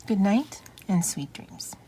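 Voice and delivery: whispered goodnight; hushed bedtime farewell. Acted. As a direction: whisper it like tucking a child in. Bedtime sign-off after story.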